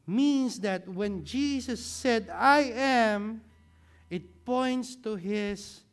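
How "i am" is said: proclaimed. Acted. Preacher proclaims Jesus' words.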